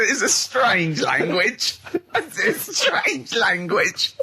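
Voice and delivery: slow, high, giggly quoting; mock the retelling, cracking up; laughing mimicry. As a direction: draw it out through helpless laughter. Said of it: "what I like in your telling".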